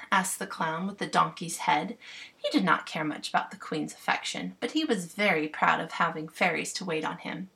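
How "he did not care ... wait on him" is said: usual speaking voice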